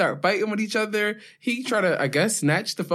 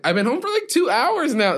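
mock boyfriend whine; 7 semitones higher than usual. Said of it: "And he was like".

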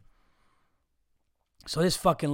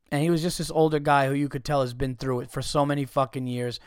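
usual speaking voice

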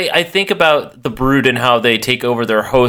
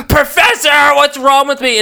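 very high, hammed-up plea; mock desperate wail; 12 semitones higher than usual. Logan begs the professor for answers, in a mock desperate wail. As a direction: whine it high, imitating someone in distress.

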